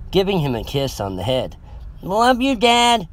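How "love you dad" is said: high-pitched called goodbye, lightly shouted; child's shout. A narrator's reading. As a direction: call back to dad as a young son. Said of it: "he shouted".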